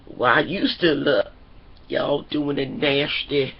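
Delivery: low, slow, drawn-out dad voice; put-on deep, suspicious father; mock dad voice. Voicing the dad in a recap.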